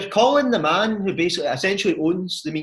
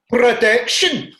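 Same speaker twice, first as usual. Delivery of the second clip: loud, slow, high cry; mock protest shout; 8 semitones higher, 9 dB louder, much slower than usual. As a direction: yell it like a kid hit in the glasses. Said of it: "he's like".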